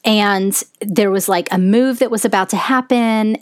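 ordinary delivery

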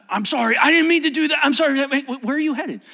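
high, loud, frantic pleading; acted panicked apology. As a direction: babble apologies in alarm. Voices rich man's panicked apology.

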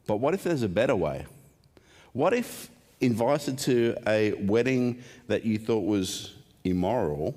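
ordinary delivery

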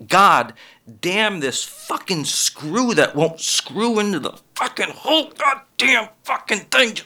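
high, strained exasperation at a screw; frustrated cursing. Retold or imitated. Comic cursing a stubborn screw, high exasperated voice. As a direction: curse the screw that won't go in. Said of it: "I'm saying".

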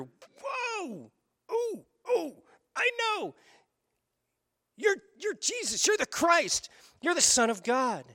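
high, bursting eureka cry; excited realization. Acted. Peter realizing Jesus is the Christ, high excited shout. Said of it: "And Peter jumps up like this".

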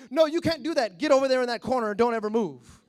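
loud, scolding order; mock strict father. Father banishing child to the corner, loud and stern. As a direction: lay down the law loudly, speeding up.

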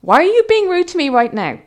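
put-on loud telling-off, as to teenagers; indignant scolding. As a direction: scold them like naughty kids. Retelling scolding men like teenagers.

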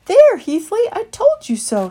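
high, loud triumphant gloat; told you so. Acted. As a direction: crow over being right. Christopher proven right.

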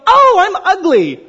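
high, drawn-out wail of self-disgust; slow, loud mock-horrified realisation; mock dismay. Preacher voicing people seeing themselves in the mirror, mock dismay. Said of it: "and we go".

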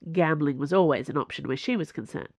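plain reading voice

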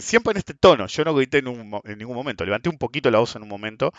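ordinary talk

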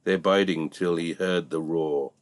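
plain reading voice

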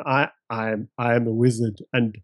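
understated imitation of a friend's one-line character intro; deadpan player voice. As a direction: play the minimal player description with mock seriousness. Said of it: "Nathan's description of him".

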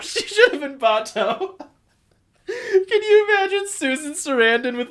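high giggling through words; laughing. Real, not acted. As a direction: laugh out the idea. Laughing at her own idea.

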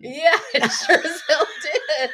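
high, slow laughing words. Real, not acted. Laughing agreement.

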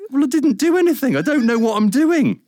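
excited disbelief. Retold or imitated. Retelling the radio job offer, laughing disbelief. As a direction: blurt it out, laughing and amazed.

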